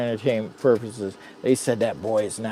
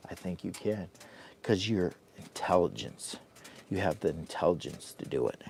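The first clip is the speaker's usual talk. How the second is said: much softer, lower coaxing; hushed plea; 6 semitones lower, 14 dB quieter than usual. Acted. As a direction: coax the algorithm softly. Pleading to the algorithm.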